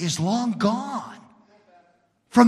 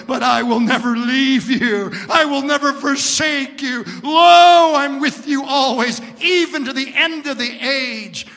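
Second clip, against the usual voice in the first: rising, louder promise; building preaching; 6 semitones higher, 6 dB louder than usual. Acted. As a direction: swell into the promise.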